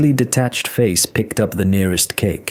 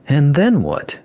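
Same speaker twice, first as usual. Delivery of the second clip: higher, louder, ironic question; 8 semitones higher, 4 dB louder than usual. A narrator's reading. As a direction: ask it with open irony. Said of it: "There was irony in the interlocutor's voice".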